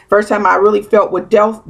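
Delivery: ordinary delivery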